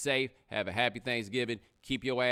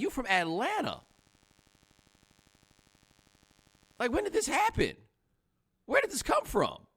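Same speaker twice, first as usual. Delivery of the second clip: very high-pitched disbelief; retold incredulity; 5 semitones higher than usual. To a friend suddenly turned Caribbean, retold incredulity. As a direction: pitch way up, then drop at each question's end.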